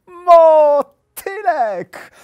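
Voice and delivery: high, loud, stretched-out squeal of delight; very high, drawn-out excited call; delighted little hare. A narrator's reading. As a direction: cry out in childlike wonder. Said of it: "Zachwycił się zajączek".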